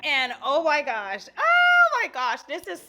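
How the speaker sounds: high, slow, ecstatic squeal; oh my gosh squealed out loud; excited squeal. Real, not acted. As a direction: squeal with pure delight. Overjoyed at Chanel PR package.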